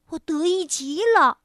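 ordinary delivery, nothing marked

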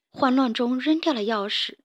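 plain reading voice

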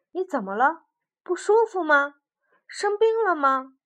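rising, anxious questions; worried. Acted. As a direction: ask with growing worry. Worried frog asks.

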